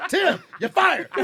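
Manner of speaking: mock stern boss. Retold firing call.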